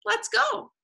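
high little fox voice; high-pitched animal character; chipper Fox. A narrator's reading. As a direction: chirp it high, ready to join in. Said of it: "said Fox".

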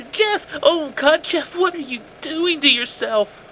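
high, loud, distraught mother's cry; moaning mother.

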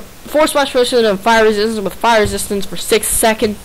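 ordinary talk, nothing marked

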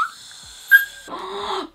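long high gasp of delight. Real, not acted. Vlogger gasping at a perfume on her skin. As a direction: gasp as the scent hits you.

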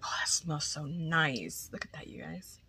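hushed delighted whisper. Real, not acted. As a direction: whisper it like a secret treat. Sniffing the gift, hushed.

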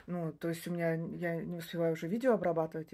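normal talking voice

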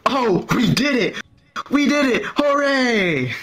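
high-pitched NASA control room celebration; mock triumphant cheering. Crowd cheering like Apollo mission control, mock triumph. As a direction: imitate a room erupting in victory. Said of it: "like the control room in nasa".

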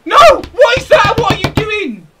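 very high, very loud yelling; furious shout. Real, not acted. Gamer yelling at his striker in the box, furious shout. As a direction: scream at your player in outrage. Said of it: "I'm so pissed".

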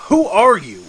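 high, loud insistent shout; alarmed shout. Acted. Man pressing the witch for her name, shouting.

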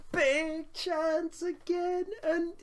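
high, very slow drawn-out cry; excited exclamation. Real, not acted. Commentating the second big chance, crying out slowly. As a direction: stretch it out as the chance comes. Another chance goes begging.

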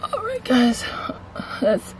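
hushed whisper to the camera. Real, not acted. Vlogger whispers to her viewers, hushed whisper. Whispering while filming in the woods.

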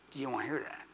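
normal talking voice

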